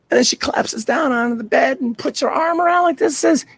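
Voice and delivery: ordinary talk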